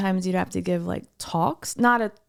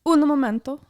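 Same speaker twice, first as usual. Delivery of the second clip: hammed-up Italian accent, higher and louder; mock Italian flourish; 6 semitones higher, 5 dB louder than usual. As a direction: play a comic Italian. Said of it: "in your best, uh, English Italian accent".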